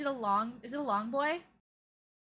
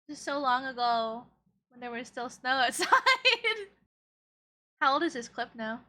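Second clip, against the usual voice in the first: ordinary talk, nothing marked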